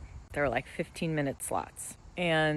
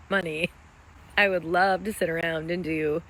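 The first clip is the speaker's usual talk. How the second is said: ordinary talk, nothing marked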